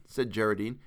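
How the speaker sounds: ordinary delivery, nothing marked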